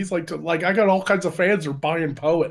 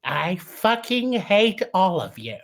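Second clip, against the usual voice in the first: Poe voice; 4 semitones higher, slower than usual. Acted. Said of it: "Read in the voice of Poe".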